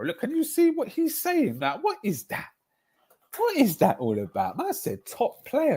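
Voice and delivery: high mock-shocked squeal at Sam; incredulous squeal. Real, not acted. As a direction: shriek it like you can't believe it. Mocking outrage continues.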